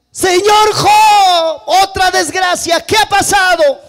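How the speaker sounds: high, loud, drawn-out call; messenger's alarmed shout. Acted. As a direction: shout news of disaster, stretching the words. Second runner arrives shouting to Job. (grito alargado y alarmado de mensajero)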